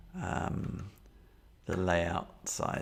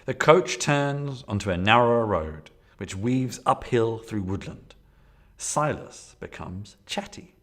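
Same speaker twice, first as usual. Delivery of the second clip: loud, dramatic gamebook read; story narration; 2 semitones higher, 5 dB louder, slower than usual. Acted. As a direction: read the scene aloud like an adventure.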